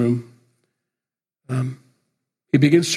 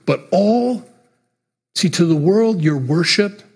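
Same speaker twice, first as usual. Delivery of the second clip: passionate burst; 4 semitones higher, slower than usual. Real, not acted. Preacher rising to a point.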